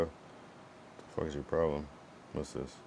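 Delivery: normal talking voice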